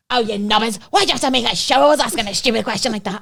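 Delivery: mate scolding them in front of the sommelier; embarrassed scolding. Retold or imitated. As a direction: scold your friends for showing you up.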